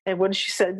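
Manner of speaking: normal talking voice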